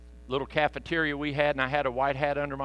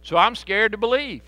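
pleading father; 7 semitones higher, 3 dB louder than usual. Retold or imitated. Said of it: "And the guy says".

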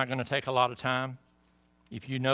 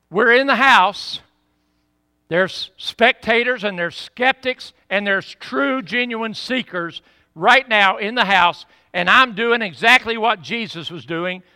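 loud, higher pulpit voice, slow on the scripture; thundering revival preacher; full-force preaching; 7 semitones higher, 13 dB louder than usual. Acted. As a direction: proclaim it to the whole house.

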